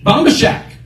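high, defiant comeback; arguing with himself. Retold or imitated. Driver answering the voice, arguing back. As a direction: fire back the rival choice. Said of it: "I had an argument with myself".

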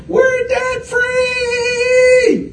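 very high, long-held celebratory yell; imitated caller's triumphant scream, hugely drawn out; debt-free scream. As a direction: scream it out, stretching the last word. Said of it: "They do a debt-free scream".

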